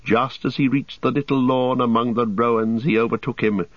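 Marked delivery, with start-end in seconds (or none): none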